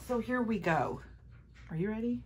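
normal talking voice

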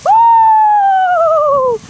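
high, loud cheering whoop; excited whoop. Real, not acted. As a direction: cheer the dog on loudly. Cheering dog over jump.

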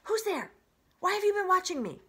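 high, loud, fast startled voice; hissing opossum. Acted. Creature hissing up at the watcher, high and fast. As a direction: snap up from the shadows. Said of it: "The creature's voice hissed".

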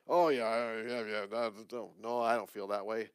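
mock airy denial, quick yeahs; dismissive brush-off. Preacher voicing a heart brushing off feelings, dismissive. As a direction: wave it away like it's nothing. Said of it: "if you try and brush off".

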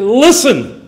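preacher's emphatic "listen". Shown as a demonstration. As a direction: punch "listen" as a single urgent command. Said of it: "I just want to say emphatically".

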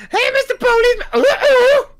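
very high squeaky cartoon clown. Fan imitating a rock and roll clown in a high squeak. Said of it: "skips to the policeman".

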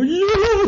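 high wailing "yeah", imitated; mock sobbing yes. Son admitting it to mom, high sobbing "yeah". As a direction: squeak it out through a sob. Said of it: "And I go".

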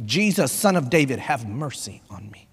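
low prayer fading to very soft; quiet prayer. Shown as a demonstration. Pastor repeats the prayer in English, praying quietly. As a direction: let the plea sink almost to a murmur.

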